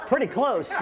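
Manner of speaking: amused disbelief at a half-right translation; incredulous echo. Retold or imitated. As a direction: pitch jumps high with a laugh.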